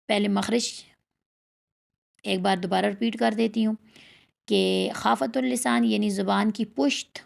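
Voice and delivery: usual speaking voice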